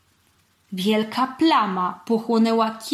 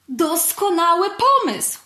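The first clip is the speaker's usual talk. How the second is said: high, loud, slow delight; approving exclamation; 9 semitones higher, 5 dB louder than usual. A narrator's reading. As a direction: hail a brilliant idea. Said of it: "wykrzyknąłem z uznaniem". (okrzyk pełen uznania)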